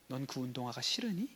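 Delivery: soft, low, careful question; understanding father. Acted. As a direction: gently ask as an acted caring dad. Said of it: "진짜 대화를 잘하는 아빠는 이렇게 하는 거야". (낮고 부드럽게 묻는 아빠 연기)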